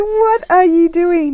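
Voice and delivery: high, loud, drawn-out question through laughter; laughing disbelief. Retold or imitated. Speaker retells questioning her husband's silliness, laughing disbelief. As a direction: ask it incredulously, barely holding back giggles.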